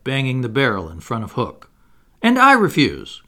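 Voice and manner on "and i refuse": put-on high, loud boyish cry; defiant cry. A narrator's reading. Smaller boy backing his brother's refusal, crying out. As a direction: join in with a higher, ringing refusal. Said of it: "cried Michael".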